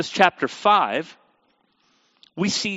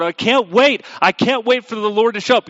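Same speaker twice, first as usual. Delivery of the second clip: higher, louder, imitating eager believers; hammed-up excitement, pitched up and loud; mock eager anticipation; 5 semitones higher, 5 dB louder than usual. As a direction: play the people who are impatient with excitement. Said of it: "the people are wanting the day of the Lord".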